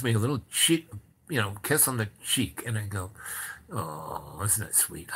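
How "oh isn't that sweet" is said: soft, lower, touched reply; tender cooing. Retold or imitated. He answers Madame's kiss on the cheek, tenderly. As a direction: coo it softly and low.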